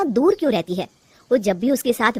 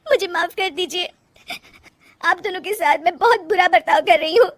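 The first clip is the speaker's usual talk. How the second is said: high, tearful pleading; weepy, high-pitched remorse; sobbing apology; 8 semitones higher than usual. Acted. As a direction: apologise through sobs. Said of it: "फूट फूट कर रोने लगती है".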